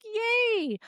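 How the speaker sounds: long, high-pitched little hooray; mock cheer. Practitioner cheering a patient now calm on planes, gleeful. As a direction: celebrate lightly, pitch shooting up.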